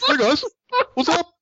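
very high, silly British greeting; mock British accent. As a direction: join in with a squeaky British hello. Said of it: "why are you talking in a British accent?".

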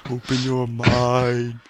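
very slow, drawn out; slow solemn quote; slow movie line. Retold or imitated. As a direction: stretch every word. Quoting Total Recall.

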